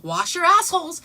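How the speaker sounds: loud, high chanted slogan; loud, high imitated protest chant; mock yell. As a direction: call it out to the street. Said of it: "She's like".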